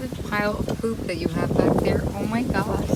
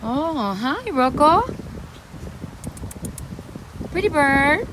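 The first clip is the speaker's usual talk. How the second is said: high, louder calling coo; pet talk; 7 semitones higher, 3 dB louder than usual. Real, not acted. Calling to the parrot Rocco, high coaxing singsong. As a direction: coax the bird with a high singsong.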